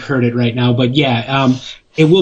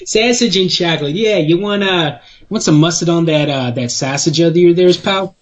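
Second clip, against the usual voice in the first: higher, hammed-up Chicago accent; 4 semitones higher than usual. Co-host joking about mustard on sausage, hammed-up Chicago accent. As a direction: play up Chicago vowels like a local. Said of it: "hear a little Chicago come out of you".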